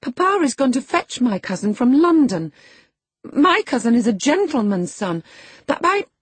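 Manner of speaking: high, loud, upset protest breaking off; near tears. A narrator's reading. Girl denying kinship with a clown, upset high voice. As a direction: insist in girlish distress. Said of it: "she stopped and wept outright".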